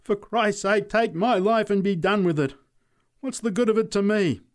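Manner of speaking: high, loud, despairing outburst; frantic shout to the crowd; wild call. A narrator's reading. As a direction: cry out as if past caring. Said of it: "called out wildly".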